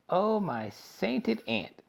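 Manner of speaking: drawn-out shocked gasp; horrified exclamation. A narrator's reading. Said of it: "cried Mister Wonka".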